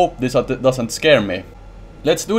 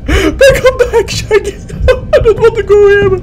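very high, whining plea; panicked begging; 4 dB louder than usual. Real, not acted. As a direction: whimper for your friend to come back. Begging companion to return.

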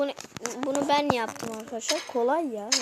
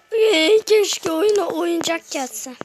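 drawn-out wish; 4 semitones higher, 8 dB louder, slower than usual. Acted. Wishing for a game update.

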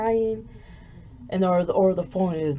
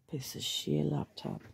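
muttered in frustration, breathy; angry whispered curse; 9 dB quieter than usual. Real, not acted. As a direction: hiss it under your breath at the laptop. Laptop fails, muttering in anger.

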